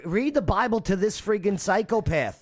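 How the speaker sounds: high, exasperated outburst; indignant rant. Real, not acted. Ranting about the dog killer.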